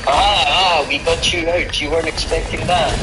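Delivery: a gloating game voice with a sneer; mocking game voice. Player mocks the game's ending with a smug gloating voice.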